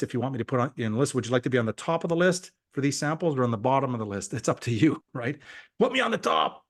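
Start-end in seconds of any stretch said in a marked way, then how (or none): 5.8-6.6 high, mock-eager plea; eager begging. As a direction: imitate someone clamouring to be picked. Voices eager people begging for samples.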